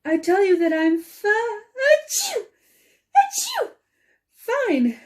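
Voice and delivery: protesting between high sneezes; sneezing mid-sentence. Acted. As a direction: insist you're fine while sneezing. Sneezes through her denial.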